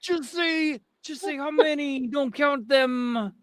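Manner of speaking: mock pleading. Villager begging the lookout not to count, mock pleading. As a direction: plead up high, dragging the last words.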